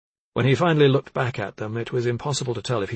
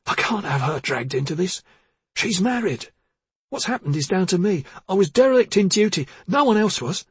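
hoarse; 9 semitones higher, 4 dB louder than usual. Acted. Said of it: "He said hoarsely".

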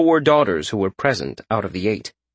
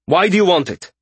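sharp, higher-pitched demand; snapped; 8 semitones higher, 4 dB louder than usual. A narrator's reading. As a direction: snap the question at a rival. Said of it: "Priveth snapped".

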